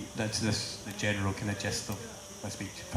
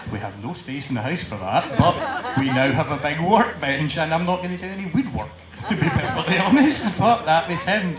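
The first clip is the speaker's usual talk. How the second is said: laughing; 5 semitones higher, 8 dB louder than usual. Real, not acted. Laughing through the story.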